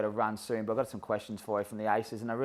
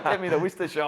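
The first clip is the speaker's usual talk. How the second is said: 1 laughing mimicry; 7 semitones higher, faster than usual. Mimics homeless man's request, laughing.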